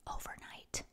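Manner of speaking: breathy whisper of Overnight, soft and drawn out; a hushed echo of Overnight. Shown as a demonstration. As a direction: whisper Overnight back to yourself. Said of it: "It was overnight".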